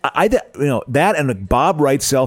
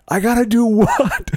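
higher and slower, mock disbelief; built-up bewildered captive; 10 semitones higher, slower than usual. Bound prisoner acting out his torturers' demand, slow rising disbelief. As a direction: stretch out the outrage like a tortured hostage. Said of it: "I build it up".

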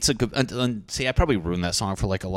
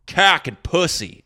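mock emphatic; 9 semitones higher, 7 dB louder than usual.

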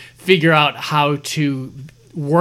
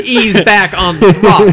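laughing; 5 semitones higher, 7 dB louder than usual. Real, not acted. Teasing banter, laughing loudly.

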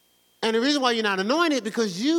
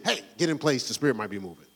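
low, soft interrupting cue; a hint of a preacher's hushed cue; quiet stage cue; 10 semitones lower, 3 dB quieter, faster than usual. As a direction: lower and softer, like a side instruction. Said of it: "As opposed to me go".

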